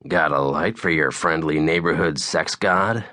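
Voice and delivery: ordinary delivery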